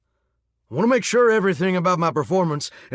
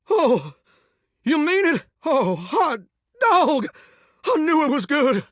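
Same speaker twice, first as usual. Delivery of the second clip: high-pitched, thrilled whoop; overjoyed; 9 semitones higher than usual. Acted. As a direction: burst out delighted at the praise. Overjoyed at praise.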